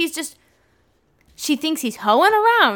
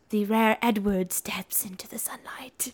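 whispered, hushed nature-film narration; mock documentary whisper; 3 semitones lower, 9 dB quieter than usual. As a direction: breathe it like a wildlife narrator.

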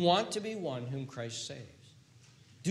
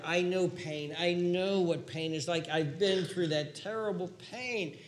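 ordinary delivery

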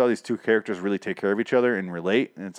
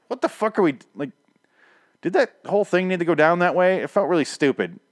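exasperated; 8 semitones higher than usual. Retold or imitated. Viewer's retold exasperated reaction.

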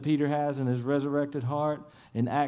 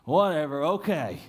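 imitating a fed-up, bitter grumble; mock exasperation; slower than usual. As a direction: drag it out slowly, then give in grudgingly. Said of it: "he's probably so mad and he's bitter".

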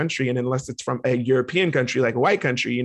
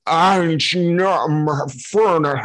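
mock defeated foreigner; 3 semitones higher, 4 dB louder than usual. Giving up on the word, mock flustered foreigner. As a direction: throw up your hands as a confused tourist. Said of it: "I'm like".

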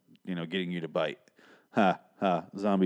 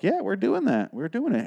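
mock eager writers; 11 semitones higher than usual. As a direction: cheer like the writers took his idea. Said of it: "they're like".

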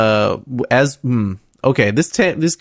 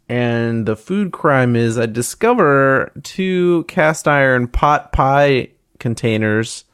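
ordinary talk, nothing marked

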